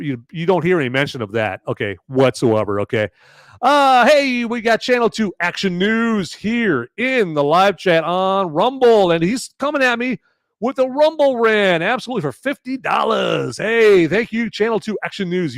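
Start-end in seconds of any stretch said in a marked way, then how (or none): 3.6-15.6 excited shout. Real, not acted. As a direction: hype up the donor, shouting. Greeting a big live chat donor.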